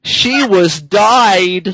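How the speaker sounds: drawn-out dramatic read; milk the tragic line slowly. Acted. Reading absurd fanfic dramatically.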